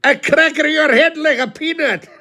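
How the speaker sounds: high, loud broken-English voice of a rough sheepherder. Retold or imitated. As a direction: bellow it in heavy broken English, mock-threatening. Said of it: "he couldn't speak very good English".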